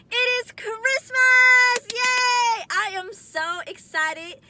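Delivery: loud, high, drawn-out cheer; excited. Real, not acted. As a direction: shout it with joy. Christmas greeting to the viewers.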